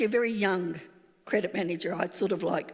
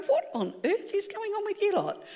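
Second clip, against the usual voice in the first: high, startled, half scolding; what on earth has happened here; pitched-up alarmed demand; exasperated surprise; 11 semitones higher than usual. Retold or imitated. Walks in on crying staff.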